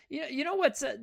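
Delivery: ordinary delivery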